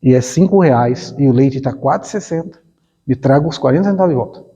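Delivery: a gentle but firm father setting terms; lightly voiced God, patient and unbending. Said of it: "E aí Deus fala assim".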